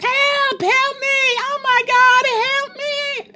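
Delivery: very high wailing mock scream; screaming old woman. Old woman in the hospital room, screaming for help. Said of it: "an old woman that is screaming".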